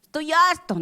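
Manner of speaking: high, whining complaint; a hammed-up fed-up whine; whiny protest. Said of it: "Usted dice".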